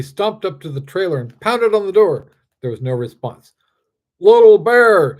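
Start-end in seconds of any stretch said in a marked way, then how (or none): 4.2-5.2 big slow shout, much louder and higher; angry shout. A narrator's reading. Strongman shouting at the bear through the door, loud. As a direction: bellow at the trailer door. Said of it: "shouted Bradley".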